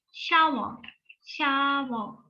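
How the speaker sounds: Shower enunciated, falling end; example word. Shown as a demonstration. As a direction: model Shower twice. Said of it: "Duş arkadaşlar".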